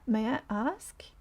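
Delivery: normal talking voice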